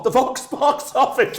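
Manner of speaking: high, loud copy of the phone greeting; echoing box office greeting. Retold or imitated. Repeating the box office line, high and loud. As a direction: parrot the box office answer brightly. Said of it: "I really like that".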